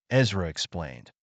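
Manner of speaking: usual speaking voice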